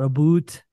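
about leaned into as "a boot"; Canadian pronunciation. Shown as a demonstration. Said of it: "They really give that".

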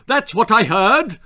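loud, high-pitched bellow; father's shout. A narrator's reading. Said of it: "shouted her father".

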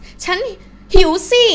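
"ฉันหิวสิ" high, with หิวสิ punched loud; emphatic. Shown as a demonstration. Teacher showing the emphasizing particle, emphatic "ฉันหิวสิ". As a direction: punch out หิวสิ after a short break. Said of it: "to emphasize".

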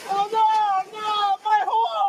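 high-pitched cry of dismay; wailing dismay. Acted. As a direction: cry out as if your prized work is being ruined. Dismay at losing his hole.

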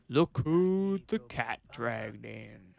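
slow put-on gruff officer voice, mildly done; character voice. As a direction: greet the returning cop like a grizzled captain. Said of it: "why am I doing that voice?".

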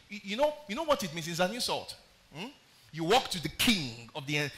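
normal talking voice

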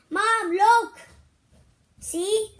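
loud, high, excited call to Mom. Real, not acted. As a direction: shout to Mom to come see. Child calls mother excitedly.

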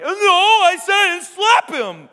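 put-on high, loud childish protest; cheeky kid excuse. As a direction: play the kid wriggling out on a technicality.

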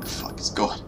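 breathless whisper. Real, not acted. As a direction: hiss it under the breath. Fleeing in fright.

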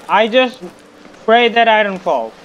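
loud, high, pleading prayer; scared prayer. Real, not acted. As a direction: beg God not to fall. Praying not to fall, music.

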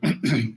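a staged little throat clear; mock throat clearing. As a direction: clear the throat as if hoarse before a talk. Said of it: "a pessoa dá um passo atrás e fala".

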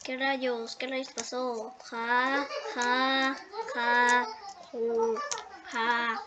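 ordinary talk

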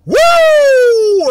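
high, loud wrestler's "Woo!" whoop; Ric Flair woo. Retold or imitated. Hyping a banger track, a loud Ric Flair whoop. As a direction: let out a big wrestling-promo whoop. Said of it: "Like I'm Ric Flair styling".

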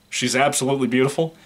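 ordinary talk

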